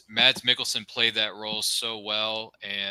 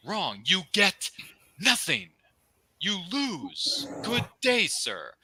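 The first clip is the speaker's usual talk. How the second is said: mock movie rant; 8 semitones higher, much slower than usual. Quotes a famous movie rant.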